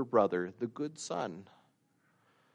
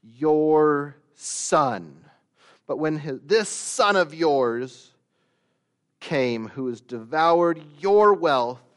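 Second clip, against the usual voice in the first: louder, slow resentful your son; bitter accusation; 4 dB louder, slower than usual. Acted. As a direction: spit out this son of yours. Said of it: "And he says".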